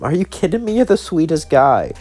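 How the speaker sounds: high-pitched disbelief; incredulous friend. Acted. Friend reassures the troubled man, in high-pitched disbelief.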